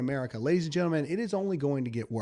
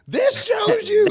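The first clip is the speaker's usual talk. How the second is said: outraged shout; 10 dB louder, slower than usual. Real, not acted. Host yelling at his audience in outrage. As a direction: shoot up much higher and much louder, slowly. Host erupts over news story.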